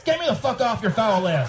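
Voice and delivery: high, mock-furious quoted email; angry reply voiced. Reading an angry reader's email in a high, mock-furious voice. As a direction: spit out the email like an outraged reader. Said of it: "Marty Paris".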